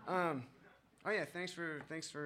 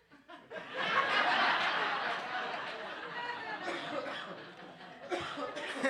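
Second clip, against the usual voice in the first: high, loud cackling imitation of the old drunk's laugh; mock laugh; 12 semitones higher, 6 dB louder than usual. Old street drinker grinning at him, high cackling laugh. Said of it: "He's all".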